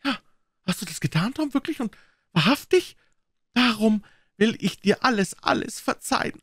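put-on high, tender old aunt; moved to forgive everything; old aunt softening with love; Aunt Polly.

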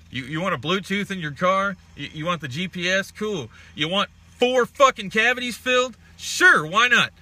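high, loud mocking upsell pitch; mock salesman. Mocking dentists as car salesmen, hammed-up high sales pitch. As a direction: ham up a pushy salesman offering extras.

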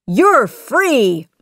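high, loud, emphatic; joyful proclamation. A narrator's reading. Aladdin grants the genie freedom, joyfully proclaiming. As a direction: proclaim the gift with joy.

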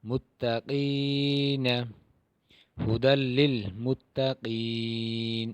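মুত্তাকিনা read slowly with the long vowels held; slow Arabic recitation. Shown as a demonstration. Teacher modelling Quranic reading for students. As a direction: draw out each syllable of মুত্তাকিনা. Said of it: "এখানে এক আলিফ টান".

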